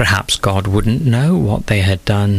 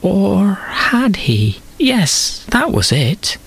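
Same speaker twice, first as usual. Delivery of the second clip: higher, slow doubt then a sudden yes; second-guess, then settle it; Eve's uncertain thought; Eve hesitating; 10 semitones higher, slower than usual. A narrator's reading.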